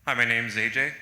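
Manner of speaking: suddenly too loud into the mic. Real, not acted. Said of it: "that was loud".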